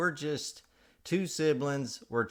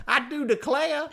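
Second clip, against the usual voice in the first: very high, loud Southern exclamation; over-the-top Southern flourish; hammed-up Southern belle accent; 6 dB louder than usual. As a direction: squeal it in mock Southern style. Comic Southern sign-off of intro.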